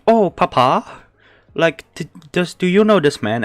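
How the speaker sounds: a hint of a curious small child; child's voice. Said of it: "the kid is like".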